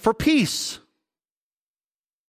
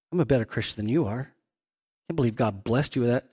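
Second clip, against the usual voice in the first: muttered envy; 10 semitones lower, 6 dB quieter, faster than usual. Pretend. Envious believer's inner words.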